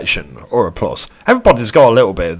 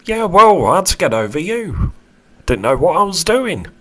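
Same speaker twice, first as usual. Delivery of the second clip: sheepish excuse; 8 semitones higher than usual. Acted. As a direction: play the guilty lover making excuses. Acting out partner's excuse.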